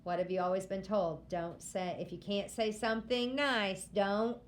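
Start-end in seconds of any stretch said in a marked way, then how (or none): none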